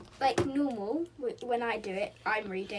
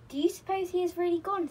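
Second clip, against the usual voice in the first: high, slightly louder worried question; a touch upset, raised pitch; 8 semitones higher, 5 dB louder than usual. A narrator's reading. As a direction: ask it with mild dismay. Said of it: "feeling upset".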